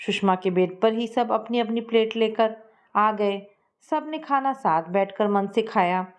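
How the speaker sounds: plain reading voice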